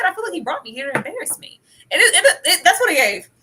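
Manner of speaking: laughing loud and high through it. Real, not acted. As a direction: cackle through the words. Laughing while quoting her.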